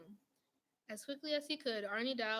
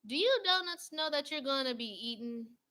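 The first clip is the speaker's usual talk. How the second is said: loud yell, a bit higher; 4 semitones higher, 8 dB louder than usual. A narrator's reading. Baker yelling across the bakery to the donuts, loud. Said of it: "The baker yelled to the other donuts".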